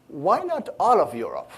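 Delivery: lightly imitated boss with a bizarre voice; Larry. Product manager quoting his boss challenging a launch plan, lightly imitated odd voice. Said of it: "I can't do his accent, actually".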